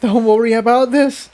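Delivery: high, tearful pony voice; a high voice trying to hold back tears; tearful mare. A narrator's reading. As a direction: comfort her through tears. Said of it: "Tiptoe said through her tears".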